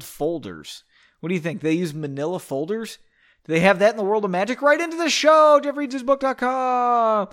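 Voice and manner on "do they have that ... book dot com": mock announcer shout. Host jokingly calling for listeners to write in, loud mock announcer shout. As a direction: holler it out high, stretching the last word. Host mockingly calls out show plug.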